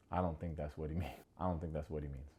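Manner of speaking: low, quiet imitated interjection; understated mock timid aside; soft meek correction. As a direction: say it small and low, half amused. Voices Caleb meekly closing it out.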